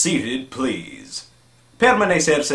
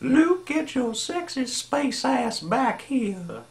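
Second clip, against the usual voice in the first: high flirty cartoon voice; 11 semitones higher, 5 dB quieter than usual. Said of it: "voice impressions".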